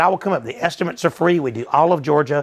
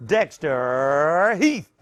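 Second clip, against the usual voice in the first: announcer name; much slower than usual. Pretend.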